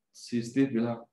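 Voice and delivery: ordinary talk, nothing marked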